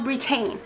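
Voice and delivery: BRITAIN said "bri-TAIN", rhyming with retain; spelling-based mispronunciation. Shown as a demonstration. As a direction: stress a full TAIN at the end of BRITAIN. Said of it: "Why is it not Britain?".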